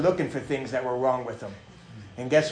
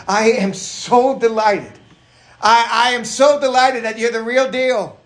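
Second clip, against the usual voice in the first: delighted; 6 semitones higher, 6 dB louder than usual. Acted. Preacher voicing John to his spiritual children, delighted. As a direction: proclaim heartfelt delight with force. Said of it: "and here John is saying".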